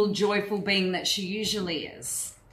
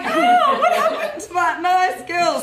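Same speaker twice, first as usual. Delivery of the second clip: laughing mock shock; 9 semitones higher, 5 dB louder than usual. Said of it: "It's like".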